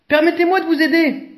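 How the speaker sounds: high, fast, eager offer; a quick bright offer of help; polite giraffe. A narrator's reading. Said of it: "dit-elle".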